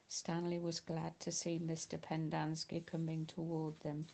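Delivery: ordinary delivery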